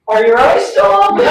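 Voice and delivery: put-on high, loud kid checking up; playful kid imitation breaking into laughter; pestering child. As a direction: mimic an excited child calling out. Acting kids in the playroom.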